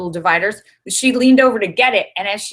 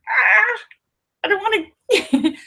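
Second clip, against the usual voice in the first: high, laughing imitated older woman; mock timid woman; 13 semitones higher, slower than usual. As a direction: shrink back like the woman. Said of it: "And the woman was like".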